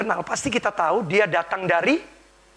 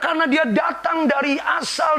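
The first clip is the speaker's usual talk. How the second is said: high, loud preaching; full-force preaching; 9 semitones higher, 6 dB louder than usual. Real, not acted. Preacher proclaiming to the congregation, loud and high.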